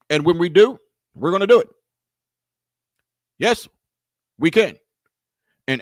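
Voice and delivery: lightly voiced Obama stump speech, stumbling before the big finish; Obama impression. Mocking Obama's Chicago promise, lightly voiced rally cadence. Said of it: "Barack Obama".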